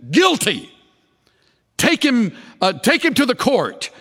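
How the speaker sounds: high, emphatic verdict; acted condemning judges. Council condemning the accused without deliberation, acted stern verdict. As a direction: pronounce it as a hostile council.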